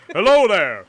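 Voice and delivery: loud, high put-on greeting; greeting as the Trapper. Voicing the Trapper to the player, loud silly greeting. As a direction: call out hello in a silly character voice.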